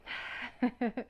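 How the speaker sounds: short laugh. Real, not acted. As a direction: laugh at yourself briefly. Laughs at herself after "stop talking.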